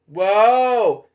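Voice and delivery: a loud high whoa that falls away; startled shout while falling; yelled whoa. Acted. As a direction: cry out as the yarn ball hits. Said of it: "he yelled".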